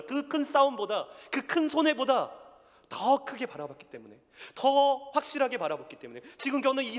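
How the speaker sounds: high, loud climactic preaching; rising, fervent pulpit cry; preaching at full force. Acted. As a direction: drive the sermon to its peak. Climactic sermon build.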